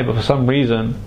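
ordinary talk, nothing marked

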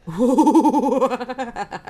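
long, loud wobbling hoot of laughter; big rolling laugh. Real, not acted. Reacting to the game.

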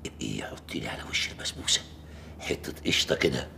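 fast secret whisper; low hushed whisper. Acted. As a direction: whisper so mom won't hear. Father speaks secretly.

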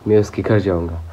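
ordinary talk, nothing marked

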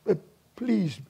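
soft, low imitation of a concerned wife; lightly voiced, hushed plea; gentle pleading quote. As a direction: retell it softly and carefully. Retells a church member's gentle complaint.

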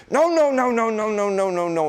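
high panicky shrieks of no, fading down; shrill alarm chasing the dog; frantic no no no. Shown as a demonstration. Owner panicking at the puppy, frantic high no's. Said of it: "the last thing we wanna do is".

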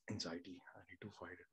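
ordinary talk

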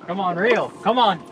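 ordinary talk, nothing marked

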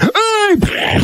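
sudden high yelp; high startled yell; mock yell. Imitating someone grabbing gun.

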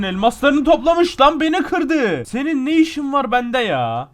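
high, loud protest; outraged rant. Real, not acted. Player complaining at the one who broke him, outraged. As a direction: rail at the rival who got you.

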